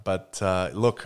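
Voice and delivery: ordinary talk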